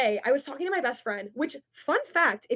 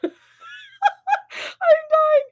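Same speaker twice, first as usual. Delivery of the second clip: laughing; 5 dB louder than usual. Real, not acted. Laughing at a comment.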